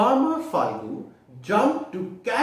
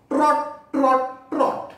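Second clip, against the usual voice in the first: high sing-song trot; 8 semitones higher, 4 dB louder, slower than usual. Acted. Cart sound in story.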